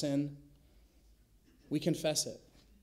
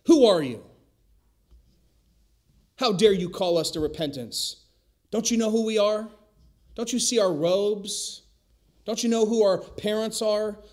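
loud, high, outraged protest; indignant Pharisees; 5 semitones higher, 9 dB louder than usual. Retold or imitated. Preacher mocking the Pharisees' outrage, loud and high. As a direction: play the offended religious elite, loud and high. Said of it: "The first group is the group that we see in the Pharisees".

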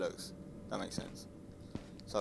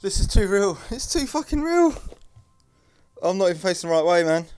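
high, loud cry of fear; panicked protest; 8 semitones higher, 8 dB louder than usual. Real, not acted. Player finding the horror game too real, panicked. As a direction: cry out, climbing to a shriek, then easing.

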